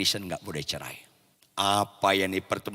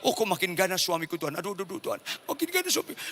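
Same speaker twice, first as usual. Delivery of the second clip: frightened; 8 semitones higher, faster than usual. Retold or imitated. Wife seeing her husband approach, high acted panic. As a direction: babble a frightened prayer. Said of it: "makin takut dia".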